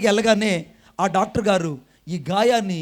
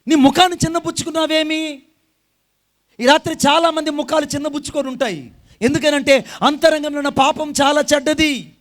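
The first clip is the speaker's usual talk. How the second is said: higher, louder full-force sermon; fiery preaching; 5 semitones higher, 6 dB louder than usual. Acted. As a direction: thunder the warning at the congregation.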